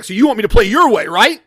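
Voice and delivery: imitating an angry player, loud and quick; really mad. Angry player challenges the man laughing at him, mock angry. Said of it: "now he's really mad".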